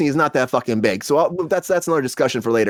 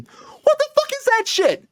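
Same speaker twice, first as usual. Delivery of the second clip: stunned disbelief; slower than usual. Real, not acted. Outraged at the hiring story.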